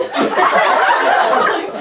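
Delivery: breathy laugh fit; burst of laughter. Real, not acted. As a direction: crack up mid-line. Laughing at scene direction.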